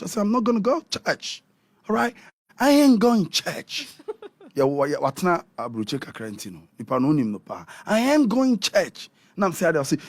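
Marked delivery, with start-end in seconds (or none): 0.2-3.9 defiant refusal. Retold or imitated. Acted refusal to go to church. 7.9-9.1 slow, higher-pitched mock defiance; defiant refusal. Repeating a retold refusal to go to church, slow and defiant.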